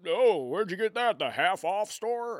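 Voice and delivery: high, loud put-on old man grousing; complaining old man. Grandfather griping about skimpy clothes at college move-in, complaining old man. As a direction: grumble in an exaggerated old man voice. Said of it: "I sound like an old man complaining".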